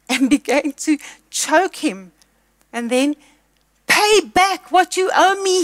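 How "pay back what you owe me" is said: high, loud, slow demand. A narrator's reading. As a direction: hammer out the debt, slow and loud. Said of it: "he demanded".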